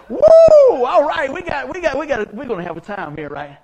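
loud, high, thrilled outburst; excited whoop. Retold or imitated. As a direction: whoop and burst out, thrilled, then laugh. Acts out own thrilled reply.

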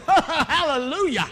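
high, loud, drawn-out hallelujah; mock panicked praise. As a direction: cover panic with overblown praise. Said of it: "'Cause I'm panicking".

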